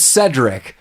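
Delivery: "Cedric" said as SED-rick; short e opening "Cedric"; correct pronunciation. Shown as a demonstration. Giving the researched name, "Cedric" pronounced SED-rick. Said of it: "I had to look this up".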